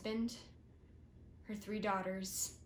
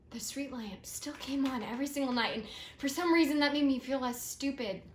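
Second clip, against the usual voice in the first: higher and much louder, near breaking; overwhelmed, almost shouting; emotional outburst; 5 semitones higher, 9 dB louder than usual. Acted. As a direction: let it swell into a cry of feeling. Emotional peak about the lamps.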